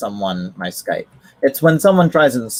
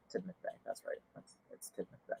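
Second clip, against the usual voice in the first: much softer, near-whisper realisation; whispered to self; 9 semitones higher, 26 dB quieter, faster than usual. Real, not acted. Spotting the route name, whispering it to himself. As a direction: breathe it out to yourself.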